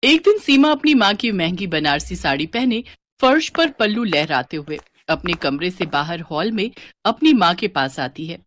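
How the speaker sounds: ordinary delivery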